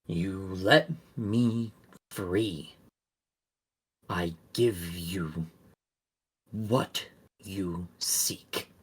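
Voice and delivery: slow, low, soft creature voice. Acted. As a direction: drag the words out, low and eerie. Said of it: "You hear in your head another, a voice".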